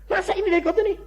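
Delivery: high-pitched incredulous protest; imitated disbelief. Visitor doubting he doesn't know the pastor, mock incredulity. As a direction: act out an astonished visitor, voice jumping high.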